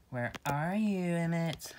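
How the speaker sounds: taunting call. Acted. Hunting Emmett.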